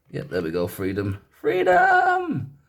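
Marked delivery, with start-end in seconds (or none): 1.3-2.6 long, high, hammed-up shout; mock-heroic yell, far higher and louder; bad-accent battle cry. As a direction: bellow it like a movie war cry, stretched out. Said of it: "that was the worst accent ever".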